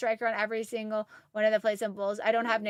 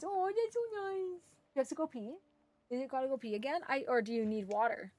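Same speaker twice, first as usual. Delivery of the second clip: much softer, coaxing the dog; soft pet talk; 11 dB quieter, faster than usual. Real, not acted. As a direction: murmur gently to a pet that whines. Asks dog if it needs pee.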